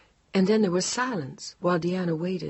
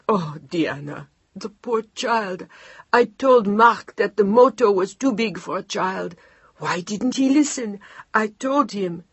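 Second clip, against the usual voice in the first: a hint of a grieving old woman, louder; lamenting grandmother; 4 semitones higher, 6 dB louder than usual. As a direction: mourn and scold at once. Grieving mother-in-law laments.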